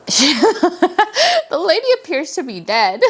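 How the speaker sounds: words breaking into real laughter; giggling as she tells it; laughing. Real, not acted. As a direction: laugh through the line in disbelief. Laughing while telling shocking twist.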